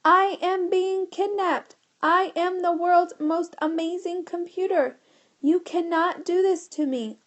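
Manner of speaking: a proud machine protesting its kidnapping; indignant computer. A narrator's reading. The kidnapped computer objecting to the boys, high robotic voice.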